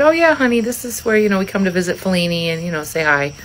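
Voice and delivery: put-on high, sing-song explaining to a child; reassuring parent. Mom explaining Fellini's grave to son, brightly reassuring. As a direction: lift the pitch, breezily soothing a little one.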